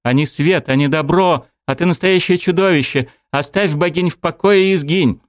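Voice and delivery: high, furious accusation; angry outcry. A narrator's reading. Dryad Elina cursing the god, high and furious. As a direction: hurl the words at the monster.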